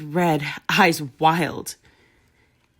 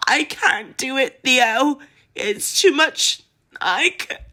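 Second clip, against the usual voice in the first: higher, louder, slow desperate tearful plea; sobbing plea; 7 semitones higher, 5 dB louder than usual. A narrator's reading. Pansy sobbing desperately to Theo, breaking down. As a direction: break down, pleading through tears. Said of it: "She sobbed even harder".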